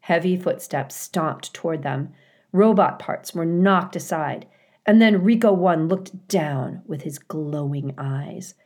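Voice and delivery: usual speaking voice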